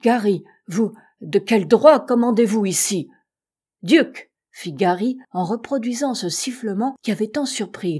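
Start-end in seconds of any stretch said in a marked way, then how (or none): 0.0-3.1 loud, outraged challenge; shocked, forceful demand; indignant cry. A narrator's reading. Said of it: "s'écria Shandon en pâlissant". 3.8-4.3 loud, high, short call; commanding call to a dog. A narrator's reading. Master calls his dog.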